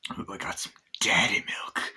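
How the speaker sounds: hushed aside, no voice; whisper. Real, not acted. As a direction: breathe it out in a secret whisper. Aside about drinking milk.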